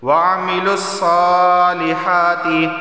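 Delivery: saalihaati chanted with long aa vowels; madd on saa and haa. Shown as a demonstration. Teacher showing standing zabar, saa and haa drawn out. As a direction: stretch saa and haa slowly. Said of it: "एक अलिफ के बराबर खींचकर पढ़ा जाएगा".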